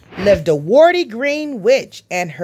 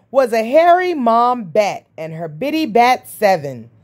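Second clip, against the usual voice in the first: ordinary delivery, nothing marked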